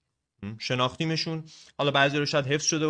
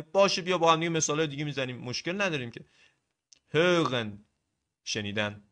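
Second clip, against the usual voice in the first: ordinary delivery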